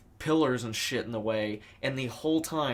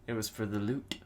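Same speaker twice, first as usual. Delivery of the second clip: Fat Man quote; 4 semitones lower, 8 dB quieter than usual. Retold or imitated. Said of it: "as Sidney Greenstreet's character".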